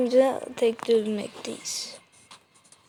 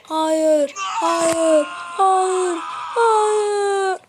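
each hayır rising higher, ending in a high cry; building wail; 7 semitones higher, much slower than usual. Real, not acted. Gamer watching his character fall, building wail of no.

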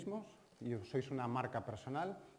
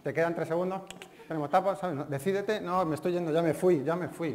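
impatient waiter; 4 semitones higher, 6 dB louder than usual. Retold or imitated. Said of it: "como dice Leo Harlem".

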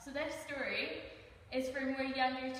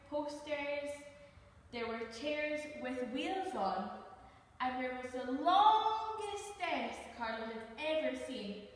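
ordinary delivery, nothing marked

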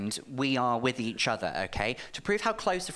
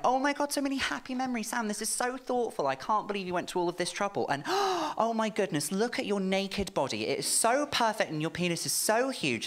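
put-on high, fast gushing boyfriend voice; breathless high gushing over a gift; 7 semitones higher than usual. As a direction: gush like the thrilled boyfriend. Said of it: "going like".

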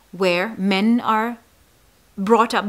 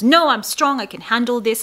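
higher, louder protest; alarmed denial; 4 semitones higher, 5 dB louder than usual. Acted. Man rejecting a doctor's depression suggestion, alarmed denial. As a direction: insist firmly, pitched up in protest. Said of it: "all the alarm bells go on and a man says".

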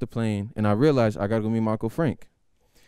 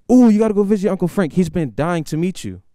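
high, loud excited mom; 8 semitones higher, 7 dB louder than usual. Retold or imitated. Mom urging her son to visit uncle, high and loud. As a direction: gush like an eager mother. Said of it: "Instantly, she goes".

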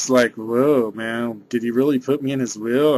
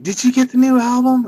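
playful impression of a pop star asking about his album; 11 semitones higher, 5 dB louder than usual. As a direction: put on a squeaky star voice to ask it. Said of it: "And then he's like".

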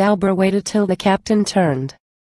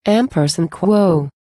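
usual speaking voice

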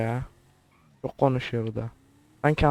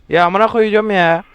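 loud, higher exasperated swearing outburst; frustrated outburst; 6 semitones higher, 10 dB louder than usual. Real, not acted. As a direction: blurt out "amına" loud in real frustration. Frustrated gamer swearing at the screen. (öfkeli, yüksek sesli küfür patlaması)

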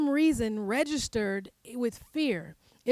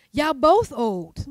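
sassy slang angel; 7 dB louder, much slower than usual. Retold or imitated. Angel's words recast as slang.

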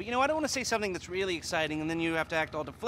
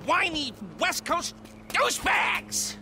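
high, drawn-out scornful outburst; exasperated insult; 10 semitones higher, slower than usual. Acted. Boss berating his bickering crew, exasperated and scornful. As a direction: build to a high-pitched jab.

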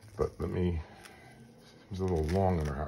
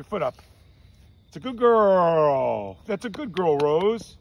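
high, loud sing-song dog talk; high-pitched pet talk; 10 semitones higher, 7 dB louder, slower than usual. Real, not acted. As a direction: coax the puppy, pitched way up. Praising the dog.

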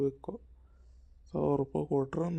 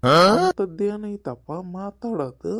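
loud protest; 3 semitones higher, 9 dB louder, slower than usual. Acted. Husband protests loudly to wife.

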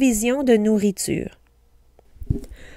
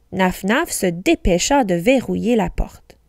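usual speaking voice

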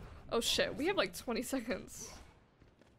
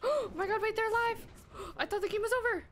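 ordinary delivery

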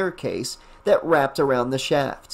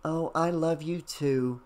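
tender; 7 dB quieter than usual. A narrator's reading. Mother answers child tenderly.

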